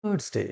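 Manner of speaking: থার্সডে with silent r, softly modelled; corrected Thursday. Shown as a demonstration. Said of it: "সঠিক উচ্চারণটা হওয়া উচিত". (থার্সডে-র সঠিক উচ্চারণ, র নীরব)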